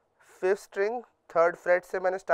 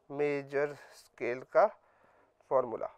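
slow summing up; 4 semitones lower, 5 dB quieter, slower than usual. Shown as a demonstration. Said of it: "this is".

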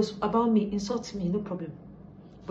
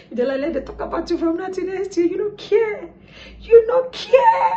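rising from higher talk into a loud, much higher shout; building to a shout; 12 semitones higher, 5 dB louder than usual. Acted. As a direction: swell into a loud laughing yell of "You don't care".

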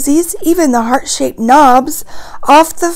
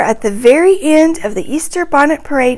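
ordinary delivery

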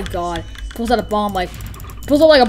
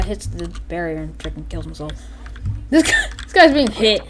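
usual speaking voice

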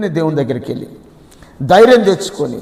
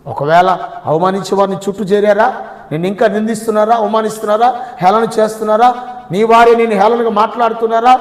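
higher, louder sermon at full force; fervent preaching; 5 semitones higher, 4 dB louder than usual. Acted. Pastor rousing the congregation about David's secret, fervent preaching. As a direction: proclaim it to the congregation.

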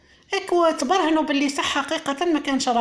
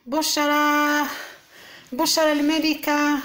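drawn-out mocking name; 2 semitones higher, much slower than usual. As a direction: stretch the name out slowly, taunting. (نداء ساخر ببطء شديد)

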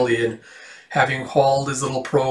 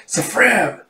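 calling out; 6 semitones higher than usual. Acted. Girl calls out the magic word.

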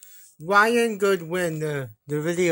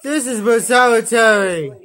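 loud announcement; 3 semitones higher, 9 dB louder than usual. Pretend. Pretend punishment announcement.

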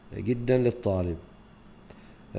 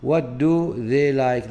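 slow model question; 2 semitones higher, 5 dB louder, much slower than usual. Shown as a demonstration. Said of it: "ليش استخدمنا do".

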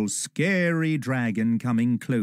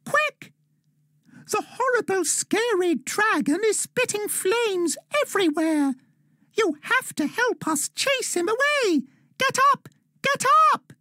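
high, bright little elf urging Dan on; squeaky elf calling for help, urgent; a high elf voice begging a boy to hurry. A narrator's reading. Elf voice in story.

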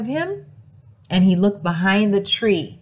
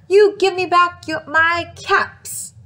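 scolding; 7 semitones higher than usual. Acted. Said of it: "he shook his finger".

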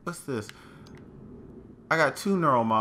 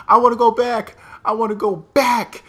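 pleading; 10 semitones higher, 10 dB louder than usual. Real, not acted. Pleading after friend is lost.

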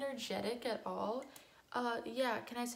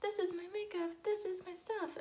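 bubbly beauty vlogger; 9 semitones higher than usual. Retold or imitated. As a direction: gush over makeup like a vlogger. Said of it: "the girls are like".

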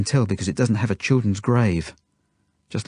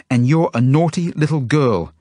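raised, louder telling-off; angry scolding; 6 semitones higher, 5 dB louder than usual. A narrator's reading. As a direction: scold the child angrily. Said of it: "she says angrily to Zelda".

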